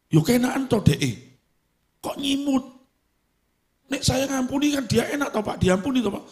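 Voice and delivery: high, pleading Javanese congregant voice; whiny congregant. Acted. Congregant whining to the pastor about forgiveness. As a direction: whine it like a church member bargaining.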